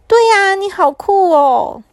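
put-on high, slow, delighted child's voice; happy and delighted. A narrator's reading. Little Pu happily tells the whale how cool he is, delighted. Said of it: "小扑也很开心地说".

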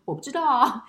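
put-on high, loud little-kid voice; child's clueless denial. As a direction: mimic a child insisting they have no idea. Retold child denies knowing why.

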